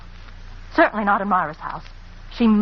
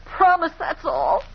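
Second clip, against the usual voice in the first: pleading; 9 semitones higher, slower than usual. Acted. Anne pleading, frightened secret.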